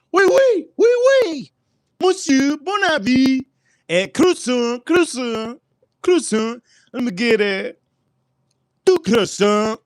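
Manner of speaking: high, hammed-up French ordering croissants; mock French accent. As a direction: overdo a fake French voice. Hamming up a French customer.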